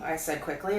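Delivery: plain reading voice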